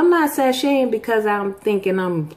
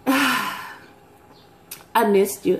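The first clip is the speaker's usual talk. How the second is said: tender; much slower than usual. Real, not acted. Vlogger to her viewers, slow and tender. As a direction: let it melt out slowly.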